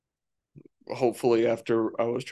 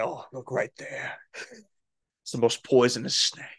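Steve Irwin. Retold or imitated. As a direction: whisper it like a croc hunter. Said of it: "Steve Irwin".

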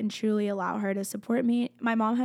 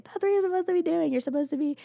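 much higher and fast, eager friends; friends urging; 9 semitones higher, faster than usual. Retold or imitated. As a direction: play the friends insisting, pitched up. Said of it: "many of them have always told me".

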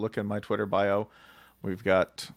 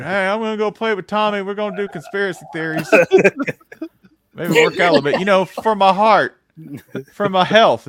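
put-on higher, louder, innocent kid voice; 11 semitones higher, 11 dB louder than usual. Pretending the gym dudes are kids making a playdate, higher and innocent. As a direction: sound like a kid off to play. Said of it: "you know, like".

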